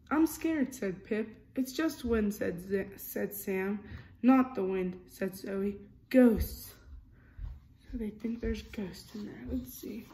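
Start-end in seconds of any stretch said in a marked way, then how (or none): 0.1-6.7 lively storybook reading, character lines a bit louder; reading to children. A narrator's reading. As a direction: read it like a bedtime picture book. Reading picture book aloud.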